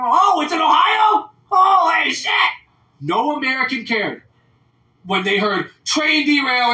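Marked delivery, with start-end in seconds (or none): 0.0-2.3 high, loud, slowed fake astonishment; mock shock. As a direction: ham up sudden shocked discovery. Mocking Americans' sudden outrage.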